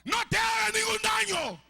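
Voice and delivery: shouted high and loud in alarm; urgent cry from Paul to the jailer; Paul's loud cry. Retold or imitated. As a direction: yell it to stop him from harming himself. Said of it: "Pablo le grita y le dice a gran voz".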